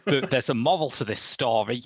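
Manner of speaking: normal talking voice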